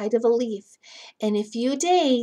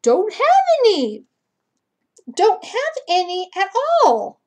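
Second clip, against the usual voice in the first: high, loud, sulky bug voice; ladybug voice; 9 semitones higher, 8 dB louder than usual. Acted. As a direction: answer like a little spotless bug. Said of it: "And this ladybug says".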